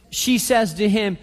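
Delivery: usual speaking voice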